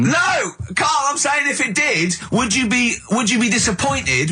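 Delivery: real frustrated outburst; exasperated shout. Real, not acted. Exasperated at Carl's dodge.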